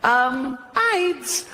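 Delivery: high squeaky goat voice; timid goat. Pretend. Goat answering the interviewer, high and timid. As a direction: answer like a nervous little goat.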